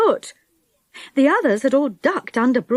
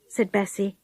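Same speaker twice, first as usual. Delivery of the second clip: plain reading voice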